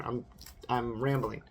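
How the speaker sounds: ordinary talk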